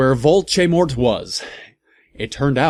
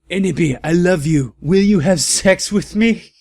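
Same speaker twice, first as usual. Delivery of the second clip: higher, louder swooning plea rising at the end; mock lovesick voice; 6 semitones higher, 6 dB louder than usual. Villain proposing to Ebony, higher swooning mock plea. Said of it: "a lovey-dovey look in his eyes".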